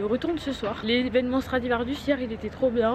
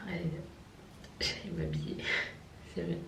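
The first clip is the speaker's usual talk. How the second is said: whispering; 4 semitones lower, 11 dB quieter than usual. Real, not acted. Whispering to camera, getting ready.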